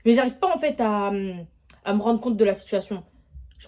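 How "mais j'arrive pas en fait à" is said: usual speaking voice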